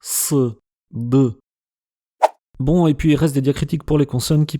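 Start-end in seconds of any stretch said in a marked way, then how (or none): 0.0-1.3 S and D with the throat constricted; Arabic-style emphatic S and D; pharyngealized consonants. Shown as a demonstration. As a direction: pull the tongue root back on S and D. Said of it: "la pharyngalisation".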